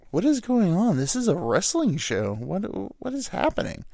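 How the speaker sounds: high-pitched, incredulous outburst; mock bewildered. Viewer baffled by a turkey at a wrestling show, mock bewildered. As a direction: pitch it way up in exasperated disbelief.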